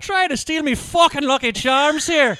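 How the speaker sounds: cartoon Irish accent. Mocking cartoon Irish ad voice, slow brogue. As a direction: grumble it stage-Irish. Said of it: "god-awful accent".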